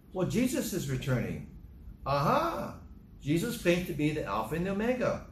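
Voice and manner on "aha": triumphant aha. Acted. Preacher reveals his conclusion.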